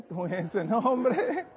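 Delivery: slow, laughing echo of the line; teasing laugh at the girl's name; laughing repeat. Retold or imitated. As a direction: chuckle the words out.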